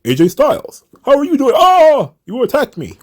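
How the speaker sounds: high, hammed-up sing-song mock; mock him in a silly high voice; hammed-up high mockery of a wrestler; mocking Damian Priest. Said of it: "Damian Priest just".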